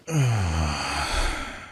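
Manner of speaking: a slow, heavy sigh; long sigh. Acted. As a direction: let out a long tired breath. Weary pause in serious talk.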